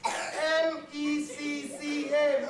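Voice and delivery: mecca spelled out slowly letter by letter; spelling out. Shown as a demonstration. Salesman spelling mecca to a confused customer.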